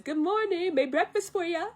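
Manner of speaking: high, cheery morning greeting; singsong wake-up call. Retold or imitated. Mushu waking Mulan with breakfast, high and cheery.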